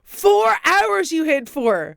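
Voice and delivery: incredulous. Real, not acted. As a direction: stretch it out in shock. Incredulous at hiding four hours.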